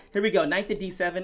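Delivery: ordinary talk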